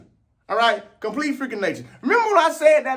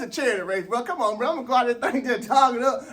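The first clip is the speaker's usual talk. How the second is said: ordinary talk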